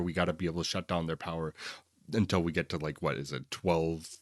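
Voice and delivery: ordinary talk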